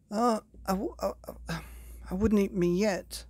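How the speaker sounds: high, desperate stammer. Acted. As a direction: stumble over it, scared stiff. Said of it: "stammered Herb desperately".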